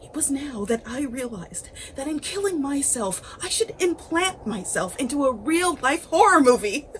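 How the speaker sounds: giddy and unhinged; a killer delighting in his own plan; manic excitement. A narrator's reading. Narrator's excitement at horror plan.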